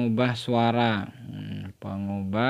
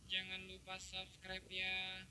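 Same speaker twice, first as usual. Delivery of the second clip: chipmunk voice; 7 semitones higher, 21 dB quieter, slower than usual. Shown as a demonstration. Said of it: "Atau ini suara tupai".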